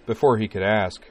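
usual speaking voice

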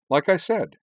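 high, loud and cheery; chirpy, pleased-with-herself announcement; cheerful declaration. A narrator's reading. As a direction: toss it out brightly and triumphantly. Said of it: "Karana declared cheerfully".

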